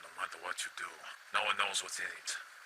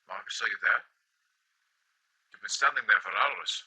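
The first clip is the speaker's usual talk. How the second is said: raised voice calling across the distance; 2 semitones higher, 7 dB louder than usual. Acted. Kent calling out to Marcus who stands frozen, raised and worried. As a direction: call to him, louder and worried.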